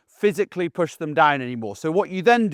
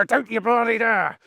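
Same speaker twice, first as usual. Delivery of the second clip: stern warning; 6 semitones higher than usual. Retold or imitated. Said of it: "and I go".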